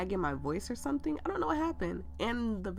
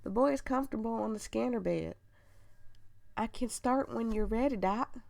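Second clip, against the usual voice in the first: higher-pitched, hammed-up country nurse accent; 4 semitones higher than usual. Nurse reporting to the doctor about the boy, country accent. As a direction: play the nurse with a butchered country twang. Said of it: "I'm gonna give him a country accent".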